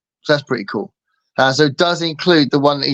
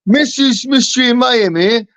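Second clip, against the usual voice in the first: high-pitched put-on voice of a streamer's partner; higher imitation voice, announcing herself; Lila; 6 semitones higher than usual. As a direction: mimic her greeting the audience, pitched up. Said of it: "how she sounds. She goes".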